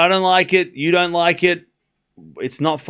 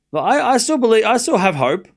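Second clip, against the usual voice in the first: hopeful; 10 semitones higher, 5 dB louder than usual. Real, not acted. Clinging to hope for Demon.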